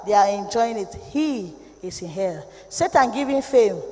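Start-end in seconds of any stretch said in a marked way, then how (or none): 1.1-2.4 low, soft and slow; hushed, heavy pronouncement; grim low verdict. Real, not acted. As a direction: drop the voice, solemn.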